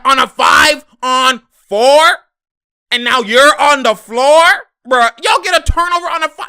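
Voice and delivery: loud, high, disbelieving yell; exasperated yelling. Real, not acted. Frustrated fan yells at his team blowing a five on four. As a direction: shout at the screen in disbelief.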